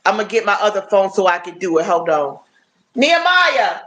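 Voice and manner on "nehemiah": higher, louder call across the house. Real, not acted. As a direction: call your son from another room. Calling her son mid-stream.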